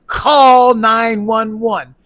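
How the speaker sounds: loud, drawn-out mock yell; imitated yelling. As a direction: yell it slowly, mocking a cry for help. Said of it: "she's yelling".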